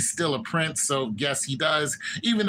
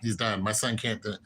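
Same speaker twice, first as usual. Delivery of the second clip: quieter and lower, a grieving mother breaking down; mock tearful lament; 5 semitones lower, 3 dB quieter than usual. Queen imitated, resigning herself to her dying son, softer and lower with mock tears. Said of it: "And she cries".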